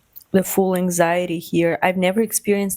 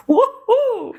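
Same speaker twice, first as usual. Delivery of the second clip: high, loud hammed-up woohoo; mock party cheer; 3 dB louder than usual. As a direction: squeal it like a raver at dawn. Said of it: "being like".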